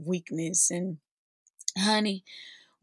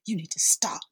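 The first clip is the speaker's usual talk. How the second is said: whispered in the ear; 2 semitones lower, faster than usual. Retold or imitated. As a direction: hush it into someone's ear. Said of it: "say in my ear".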